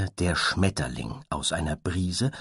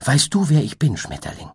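hopeful; 7 semitones higher, 5 dB louder than usual. Acted. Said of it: "fragte das Einhorn voller Hoffnung".

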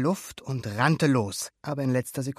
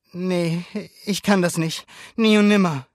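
louder, raised, balking refusal; anxious refusal; 6 semitones higher, 7 dB louder than usual. A narrator's reading. Luca refuses to ride, anxious protest. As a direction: back out, louder to the end.

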